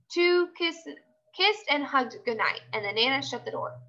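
ordinary delivery

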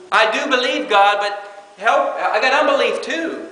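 earnest plea. Retold or imitated. Believer praying to God for help, earnest plea. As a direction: beg God for help with your doubt.

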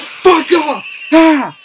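very loud, high shriek; real startled screaming; panicked scream. Real, not acted. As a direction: scream in sudden terror. Ghost attacks again.

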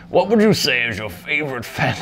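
hammed-up old wizard question. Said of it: "like an old wizard voice".

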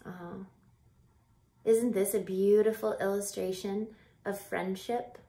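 usual speaking voice

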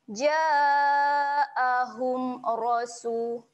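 Ja'ahum rasu very slow and drawn; recited demo. Shown as a demonstration. Teacher demonstrates clear reading for students, slow chant. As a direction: chant it clearly, no nasal hum. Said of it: "Jangan dengungkan dekat sini".

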